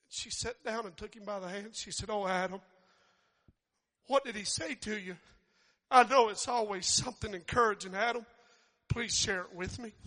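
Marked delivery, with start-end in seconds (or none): 2.1-9.9 louder, eager wife pressing for good news; wife urging her husband to share encouraging words, eager and loud; eager pleading. Acted. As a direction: coax him to share, brightly and loud. Eve eagerly asks Adam.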